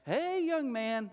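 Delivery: put-on high, slow drawn-out old man greeting; slow, high folksy greeting. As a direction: stretch it out like a friendly old Southern man.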